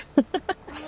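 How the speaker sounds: quick laugh at himself; amused chuckle; short laugh. Real, not acted. Laughs at own pirate voice.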